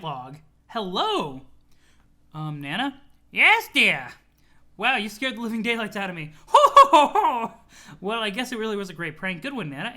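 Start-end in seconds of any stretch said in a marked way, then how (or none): 3.3-4.1 put-on sweet old grandma voice; chirpy granny, doting on her grandson; Nana voice. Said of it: "You love my Nana voice?". 6.5-7.5 high jolly granny chuckle, ho ho ho; loud cheery old lady laugh; Nana's laugh. Acted. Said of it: "You love my Nana voice?".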